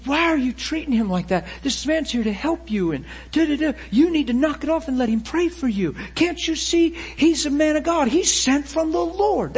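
high-pitched, insistent telling-off; retold scolding. Friends telling him off for mistreating the man, high insistent scolding. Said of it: "And, and they said".

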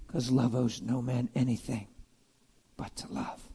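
soft, fading into a whisper; hushed whisper. Real, not acted. Preacher closing the thought on love in a whisper. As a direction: let it drop to a whisper.

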